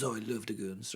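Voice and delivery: low-key, very soft imitation of a royal; Charles. Charles tells the comic he loves the Goons, hushed royal voice. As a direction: drop the volume for a mock royal reply. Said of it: "He goes".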